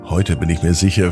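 ordinary delivery, nothing marked

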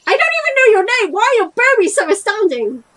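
high, loud exasperated outburst; exasperated shout. Acted. Player yells at game character blocking the screen, exasperated shout. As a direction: shout it in high-pitched disbelief.

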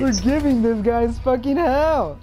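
loud, high hype yell; hyped victory shout; shouting orders over battle. Real, not acted. Celebrating a clutch play.

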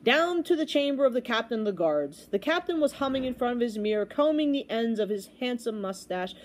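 usual speaking voice

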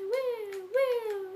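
high, bright whoops of joy; excited whoops. Real, not acted. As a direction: cheer it out, high. Excited cheering in game.

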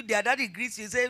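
usual speaking voice

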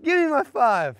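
high-pitched elated crowing; triumphant demand. Real, not acted. Golfer claiming five dollars won from friend, high and gleeful. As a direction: crow for your winnings.

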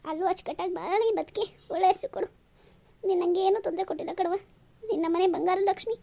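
ordinary talk, nothing marked

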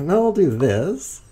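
high, slow playful sing-song; sing-song announcement. Pretend. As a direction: sing the move out like a little tune.

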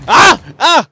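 two sharp high screams of fright; startled scream. Real, not acted. Gamer spooked by an enemy behind him, frightened screams. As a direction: jump out of your skin and shriek.

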